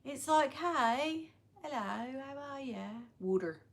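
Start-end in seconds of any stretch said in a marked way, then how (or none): 0.5-2.8 soft northern English greeting; quiet, put-on English soap accent; Coronation Street accent. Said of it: "Coronation Street does that to me". 3.2-3.6 "Water" as Philly "wooder"; Philly accent. Shown as a demonstration. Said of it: "I can do the Philly".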